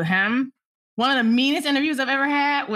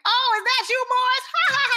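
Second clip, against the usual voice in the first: very high, gushing hello with a put-on laugh; effusive greeting; 14 semitones higher than usual. [name] greets the male photographer, exaggeratedly delighted. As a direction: coo over a favorite visitor, mock-delighted.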